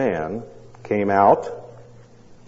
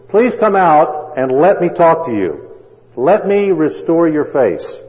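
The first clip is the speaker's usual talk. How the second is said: louder, higher pleading call; cried out loudly; 6 semitones higher, 5 dB louder, slower than usual. A narrator's reading. Doctor pleading through the door with the husband, loud. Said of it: "So he cried out again loudly".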